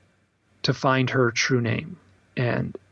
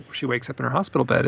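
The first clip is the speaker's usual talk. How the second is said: normal talking voice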